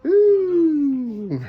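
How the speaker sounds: high, loud delighted cheer; excited whoop. Real, not acted. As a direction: let out a thrilled woo. Excited at big firework cakes.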